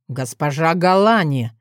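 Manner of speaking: ordinary delivery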